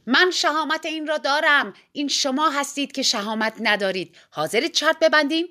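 insistent shout ending in a challenge. A narrator's reading. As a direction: fire back a loud dare. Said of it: "فریاد زد".

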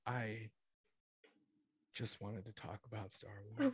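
soft, small, dejected mumble; sad little kid. Retold or imitated. Traumatized kid defending himself, soft and dejected. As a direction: whimper it softly. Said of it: "And he's just like".

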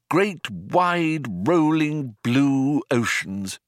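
drawn-out nostalgic voice; wistful Arthur. Acted. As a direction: savour each word like a lost home. Arthur dreams of oceans.

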